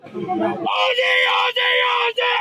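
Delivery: high, stretched-out sporting chant; shouted hurrah. Real, not acted. Fan giving a last hurrah for Australia, shouted chant. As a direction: belt out a rallying fan chant. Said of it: "One last hurrah for Australia".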